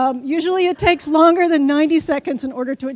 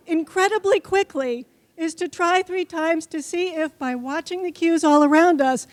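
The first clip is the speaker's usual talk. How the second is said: ordinary talk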